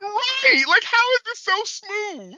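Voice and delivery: high pitched disbelief; incredulous. Real, not acted. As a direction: squeak out in disbelief. Shared disbelief about suit.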